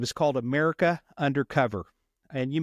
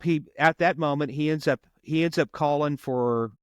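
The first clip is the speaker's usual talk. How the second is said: ordinary delivery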